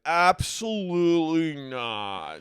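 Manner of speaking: drawn-out, high, loud denial; mock indignant denial. Man denying he hit on her, imitated as drawn-out protest. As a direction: stretch it out in hammed-up protest. Acted denial in retold argument.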